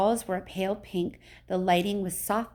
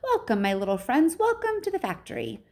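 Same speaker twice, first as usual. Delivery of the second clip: bright high call of welcome; high-pitched, welcoming storybook host; high and fluty; 6 semitones higher than usual. A narrator's reading. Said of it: "His voice was high and fluty".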